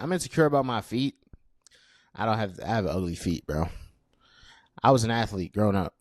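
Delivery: normal talking voice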